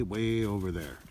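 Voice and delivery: normal talking voice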